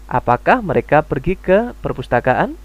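"Apakah mereka pergi ke perpustakaan" asked with a rising end; question intonation. Shown as a demonstration. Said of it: "Seperti itu".

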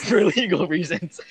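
laughing out the words. Real, not acted. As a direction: laugh through the echo. Laughs while echoing.

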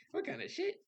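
high, sharp 'what, bro'; stunned disbelief. Retold or imitated. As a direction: jump up high in shock. Hearing his eyes rolled back.

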